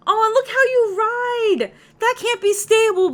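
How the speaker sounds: normal talking voice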